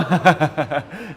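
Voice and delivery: loud, high burst of laughter; hearty laugh at being teased. Real, not acted. Laughs at listener's surprise.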